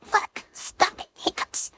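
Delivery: breathy whispered curse; whispered frustration. Real, not acted. To herself, fed up with the hiccups, whispered. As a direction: hiss it out under the breath.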